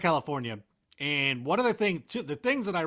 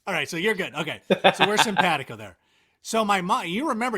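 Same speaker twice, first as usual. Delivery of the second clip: usual speaking voice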